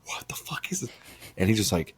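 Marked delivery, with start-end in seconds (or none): 0.1-0.9 high, hushed retold disbelief; shocked disbelief. Man recalling first seeing the video, high hushed shock. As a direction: act out stunned disbelief under your breath.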